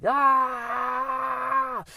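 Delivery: long, high full-throated yell; loud sustained scream to let it out. Shown as a demonstration. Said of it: "and just yell".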